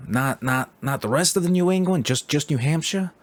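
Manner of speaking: put-on older stranger disbelieving they only visited New Hampshire; incredulous New Yorker. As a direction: play him baffled, a New York old-timer. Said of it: "He's like".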